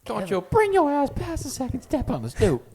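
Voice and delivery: high, strained comic voice; Kevin Hart impression. Quoting comedy bits to a friend, high-pitched warning. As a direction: squeal the warning as a stand-up bit. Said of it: "Kevin Hart".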